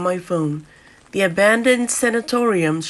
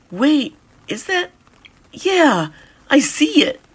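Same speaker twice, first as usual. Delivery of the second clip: higher and louder, a sudden thrill of finding it; excited discovery; 7 semitones higher, 4 dB louder than usual. Acted. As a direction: catch sight of the ruin and get excited. Spotting the ruined building.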